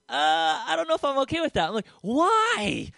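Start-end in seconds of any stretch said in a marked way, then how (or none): 0.1-1.7 high, louder imitation of a hesitant girlfriend; mock girlfriend voice. 2.0-2.9 mock baffled why. His baffled one-word reply.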